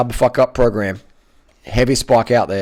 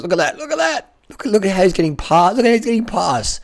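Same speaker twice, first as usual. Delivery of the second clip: excited; 10 semitones higher than usual. Real, not acted. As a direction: pitch soaring excited commentary. Excited race commentary climax.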